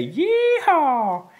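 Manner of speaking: a high, loud southern whoop; Yeehaw shout. Pretend. Telling beer fans to shout Yeehaw as a high whoop. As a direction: holler it like a cowboy. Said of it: "just say".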